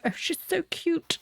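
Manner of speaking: high-pitched mock cooing; fawning coo. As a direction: gush over a cute little dog. Callers fawn over the dog.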